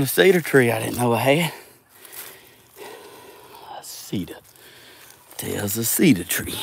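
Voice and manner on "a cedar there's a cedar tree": whisper. Real, not acted. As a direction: breathe the words unvoiced. Whispering to himself about cedar.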